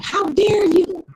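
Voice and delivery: high, indignant mock outrage; imitating an offended man. Mocking the caller on the radio show who was offended. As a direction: ham up his huffy objection. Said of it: "This dude came off of mute and was like".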